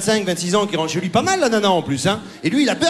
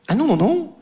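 put-on high, insistent, childish refusal; mock neighbour; 7 semitones higher than usual. Comedian voicing the neighbour's demand, high and insistent. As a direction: demand the bar like a spoilt child.

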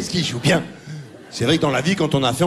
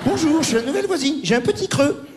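high, coy hammed-up neighbour voice; mock neighbour; 10 semitones higher than usual. Comic imitating the neighbour walking in, high and coy. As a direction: play the flirty new neighbour, pitched up. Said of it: "Alors, on fait".

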